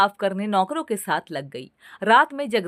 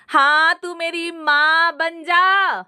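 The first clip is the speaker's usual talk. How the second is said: high, slow, pouting sarcasm; mock her with a pout; sulky sarcasm; 8 semitones higher, 4 dB louder, slower than usual. A narrator's reading. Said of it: "सास मुंह बनाते हुए बोली".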